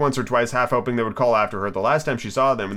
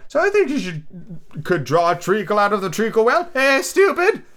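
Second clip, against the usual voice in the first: high, theatrical taunt; condescending eccentric; scornful Hatter; 9 semitones higher than usual. Acted. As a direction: jeer it in a high voice. Hatter voiced in story.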